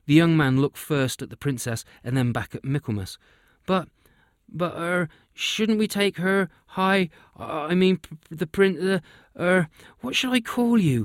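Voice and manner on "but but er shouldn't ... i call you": nervous stammer, pitch climbing higher; stuttering. Acted. Young man unsure how to address the princess, stammering nervously. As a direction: trip over every word, flustered. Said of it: "he stuttered".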